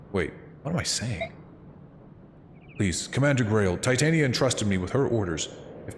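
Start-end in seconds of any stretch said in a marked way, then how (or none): none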